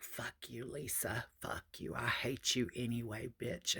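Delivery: imitating a drunk friend's cursing, soft and breathy, half whispered; lightly voiced drunken anger, kept hushed; mock angry drunk. Drunk friend cursing the wrong woman, mock hushed angry whisper. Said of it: "So she starts getting angry".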